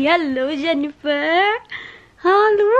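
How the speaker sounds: singsong cooing hello, rising at the end. Real, not acted. Greeting her baby.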